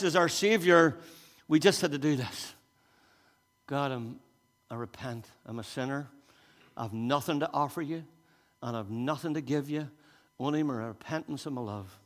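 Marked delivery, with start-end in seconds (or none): 3.7-12.0 soft, hushed repentant prayer; humble prayer. Acted. Believer confessing to God, soft and humble.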